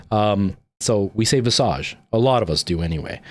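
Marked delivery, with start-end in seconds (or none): 1.4-2.0 Visage as "vih-SAHZH"; French-style pronunciation. Shown as a demonstration. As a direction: lean on the second syllable of Visage. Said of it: "we have that French influence".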